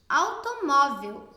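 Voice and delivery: slow, loud "automóvel" with MÓ stressed. Shown as a demonstration. Teacher giving a paroxítona example, MÓ stressed in "automóvel". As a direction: hit the next-to-last syllable MÓ in "automóvel". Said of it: "Então, mó é a mais forte".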